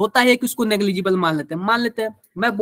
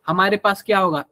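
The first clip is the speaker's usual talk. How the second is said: ordinary delivery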